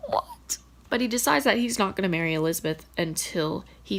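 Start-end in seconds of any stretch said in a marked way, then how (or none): 0.1-0.6 a squeaky high what; disbelieving what. Real, not acted. Reader reacts to Victor's romantic reply, squeaking in disbelief.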